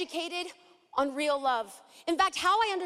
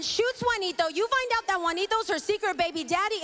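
ordinary talk

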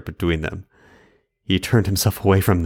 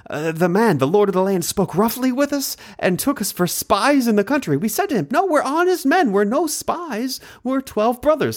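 lightly voiced anxious brother, higher; worried brothers; 11 semitones higher than usual. Sons telling their father Jacob what happened, a hint of anxious brother. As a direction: brothers pleading their case, a touch higher.

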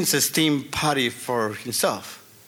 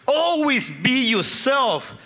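higher, slow heartfelt advice; earnest advice; 6 semitones higher, slower than usual. Retold or imitated. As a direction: lay it down slowly, meaning every word. Father's heartfelt advice to son.

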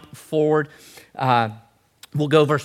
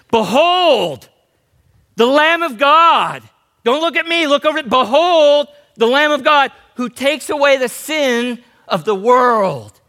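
booming prophet's proclamation, high and drawn out; loud, urgent heralding cry; emphatic yell; 10 semitones higher, 8 dB louder than usual. Acted. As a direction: shout it out over a crowd, stretching the key words. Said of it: "I'm about to yell because it's emphatic".

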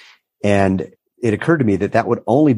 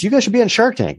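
excited urging; faster than usual. Retold or imitated. Quoting people urging them on.

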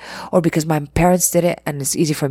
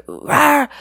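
playful mock animal rawr, pitched high; a quick hammed-up roar; 9 semitones higher than usual. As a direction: growl it like a cartoon beast. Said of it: "like an animal".

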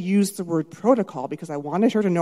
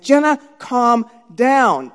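loud, higher, very drawn-out order; stressed command; 4 semitones higher, 8 dB louder, much slower than usual. Retold or imitated. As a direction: stretch each word forcefully, stress mounting. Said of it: "my stress was in my throat".

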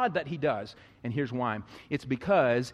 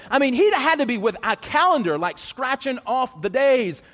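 loud, high, slowing preacher's build-up; preaching at full force; 9 semitones higher, 9 dB louder than usual. Acted. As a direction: drive it home to the congregation, climbing.

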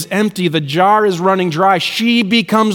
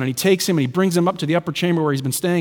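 ordinary talk

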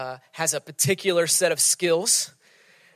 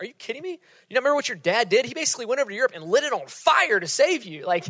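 incredulous pleading; 4 semitones higher than usual. Retold or imitated. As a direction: push in, amazed she forgot. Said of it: "We're like".

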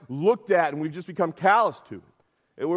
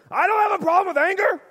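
much higher and louder, indignant snap of a denial; defensive denial; 13 semitones higher, 5 dB louder than usual. Retold or imitated. Accused man indignantly denies having an anger problem. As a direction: bristle like someone accused of anger.

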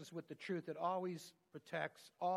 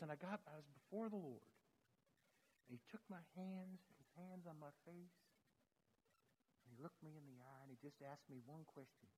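very soft, hushed retelling; softly, as if in holy presence; hushed awe; 3 semitones lower, 14 dB quieter than usual. Retold or imitated. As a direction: lower your voice to almost nothing, awed. Hushed retelling of meeting the Lord.

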